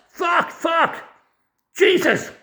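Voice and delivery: furious shout. Real, not acted. Man ranting about the system on his video, furious shout. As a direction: curse it out at the top of your voice.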